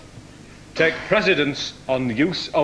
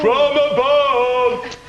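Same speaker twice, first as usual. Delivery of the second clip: hammed-up announcement; 8 semitones higher, 3 dB louder, much slower than usual. Host calls out round name.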